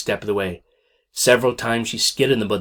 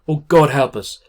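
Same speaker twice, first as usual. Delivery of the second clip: frightened plea; 6 semitones higher, 7 dB louder than usual. Acted. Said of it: "Ma reared back with fright".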